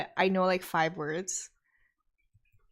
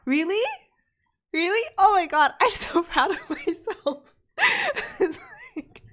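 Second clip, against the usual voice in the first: high, loud, excited burst with laughter; delighted surprise; 13 semitones higher, 9 dB louder than usual. Real, not acted. Streamer hears her translation guess was right, excited and proud. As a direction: exclaim in thrilled disbelief, breaking into laughs.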